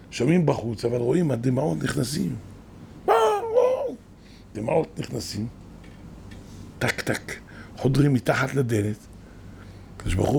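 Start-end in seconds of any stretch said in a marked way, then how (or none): 3.1-4.0 mock wailing. Imitating crying sound. 6.8-7.4 dripping tears sound. Retold or imitated.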